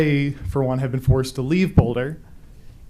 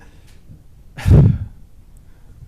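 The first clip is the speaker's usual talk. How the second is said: long, loud shaky exhale; heavy sigh; 9 dB louder than usual. Real, not acted. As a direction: let out a heavy breath to steady yourself. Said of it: "let me collect myself a little bit".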